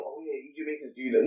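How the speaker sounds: low, soft mock quote. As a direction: mimic her generalizing, voice dropped right down. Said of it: "No, don't, don't stereotype".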